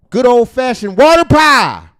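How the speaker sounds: loud, high, slow holler; hyped announcement. Real, not acted. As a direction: belt it out, stretching every word. Hyped announcement of first item.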